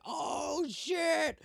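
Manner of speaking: very high drawn-out holler; hooting group reaction. Retold or imitated. Cops hearing a second forehead joke, hooting reaction.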